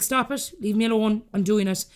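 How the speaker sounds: ordinary delivery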